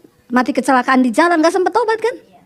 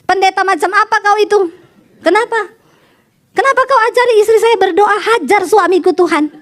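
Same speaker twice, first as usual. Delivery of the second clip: high-pitched retold phone tirade; mock-outraged caller; indignant husband; 5 semitones higher than usual. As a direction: demand an explanation, pitch raised. Angry husband phones the pastor.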